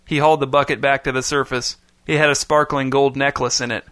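ordinary talk, nothing marked

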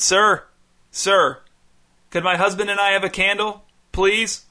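shouted. A narrator's reading. Calling upstairs to the neighbor for a candle, shouting. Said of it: "Then she shouted".

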